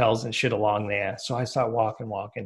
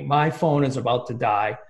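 ordinary talk

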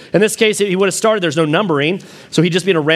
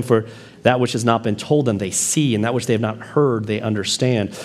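scripture reading; 4 semitones lower than usual. Acted. Pastor reads Isaiah aloud.

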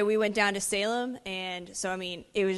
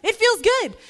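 high, loud burst of joy; imitated excited cry; 10 semitones higher, 9 dB louder, slower than usual. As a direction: exclaim like someone suddenly healed. Retold woman's joy at healing.

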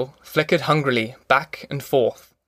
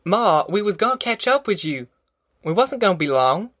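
crying out; 6 semitones higher than usual. A narrator's reading. Tom assuring Ma they would catch up, mild cry of protest. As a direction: protest earnestly in country dialect. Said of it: "Tom cried".

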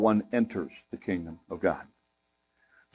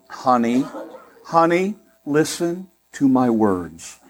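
slow, sugary "honey, honey, listen to my words"; drawn-out sweet warning with a light laugh; sweet Southern wife; slower than usual. Retold or imitated. Husband imitates his wife's sweet warning, slow and sugary. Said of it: "she does it in such a sweet way".

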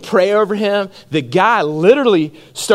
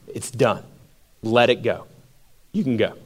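mock stern dismissal, low and soft; 8 semitones lower, 7 dB quieter than usual. Authorities dismissing the apostles.

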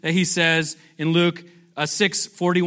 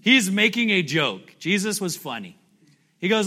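ordinary delivery

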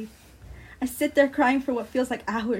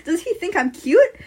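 higher, louder racing inner panic; buzzing thoughts; 4 semitones higher, 7 dB louder than usual. Acted. Narrator's thoughts racing after being called cute, flustered panic. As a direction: spiral through flustered questions. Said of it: "in my head, my thoughts are buzzing".

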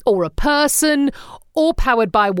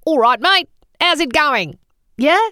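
higher, mock-Aussie greeting through a screwed-up face; squinting Australian accent; 6 semitones higher than usual. Shown as a demonstration. Proving husband's squinting-Cockney theory, mock Australian accent. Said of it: "Cockney accent spoken by someone squinting".

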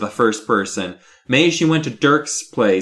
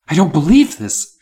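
raised, shaken disbelief; a touch of tremble, higher and louder; trembling voice; 5 semitones higher, 6 dB louder than usual. A narrator's reading. Stunned Miss Carmen staring down at Gary, trembling voice. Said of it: "in a trembling voice".

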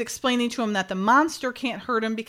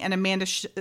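ordinary delivery

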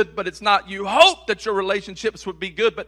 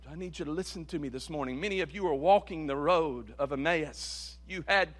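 usual speaking voice

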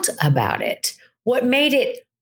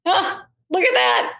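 higher, lightly amused take; playful alternative reaction, higher; amused shrug; 9 semitones higher than usual. Pretend. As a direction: shrug it off with a chuckle in the voice. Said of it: "I could have gone".

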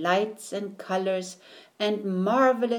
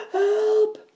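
high, strained call for help; feeble groaned cry; 14 semitones higher, 5 dB louder than usual. A narrator's reading. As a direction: cry out weakly, out of air. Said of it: "he groaned feebly".

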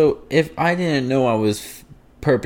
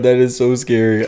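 normal talking voice

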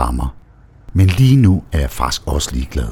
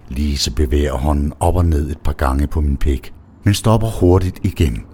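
usual speaking voice

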